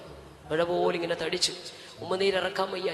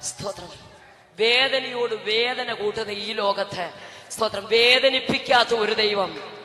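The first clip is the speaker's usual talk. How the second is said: slow, louder proclamation building toward a shout; impassioned preaching; 4 semitones higher, 10 dB louder, slower than usual. Acted. Pastor tells the church God heals, slow rising preaching shout. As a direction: drag out each phrase and lift it to the crowd.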